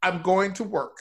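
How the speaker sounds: ordinary delivery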